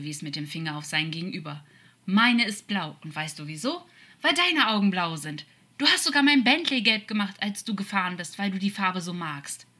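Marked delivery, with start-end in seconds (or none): 2.0-9.7 higher, animated appeal; impassioned, pushing reminder; urgent insistence. Acted. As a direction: press the shared memory on him, starting loud. Demon insists on shared memories mid-fight.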